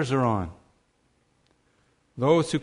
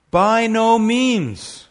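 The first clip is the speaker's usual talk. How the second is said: loud, slow, high "By no means", hammering "no"; emphatic proclamation; 7 semitones higher, 7 dB louder, much slower than usual. Acted. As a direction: thunder the denial from the pulpit. Preacher's emphatic answer.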